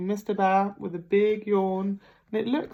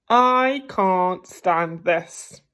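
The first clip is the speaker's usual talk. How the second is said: very slow, louder fed-up complaint; exasperated; 5 dB louder, much slower than usual. Acted. Mr Bear kept awake by garden noises, slow exasperated complaint. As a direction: stretch it out, worn out and fed up.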